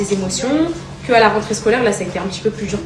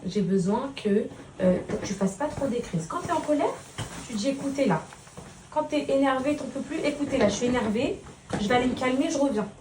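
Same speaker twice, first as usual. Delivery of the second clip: calm him so he listens; gentle mother talk; 12 dB quieter than usual. Real, not acted. Mother talks softly to her son about school.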